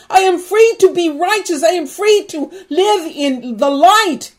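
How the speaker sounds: loud, high, fervent preaching; preacher at the peak, proclaiming freedom; full-force preaching. Acted. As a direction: shout the victory to the congregation. Preacher's loud climactic declaration.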